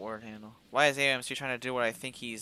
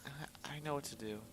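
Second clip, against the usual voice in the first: hushed, soft and steady; soft reassurance; 13 dB quieter than usual. Acted. Reassuring her exhausted partner in a hush. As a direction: quietly promise it will be fine.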